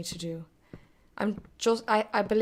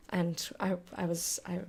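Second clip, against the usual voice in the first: ordinary talk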